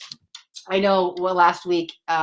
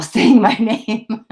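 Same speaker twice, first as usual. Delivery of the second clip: louder, breaking into laughter; laughing; 2 semitones higher, 9 dB louder, much slower than usual. Real, not acted. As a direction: laugh through the end of it. Laughing while reading viewer's comment.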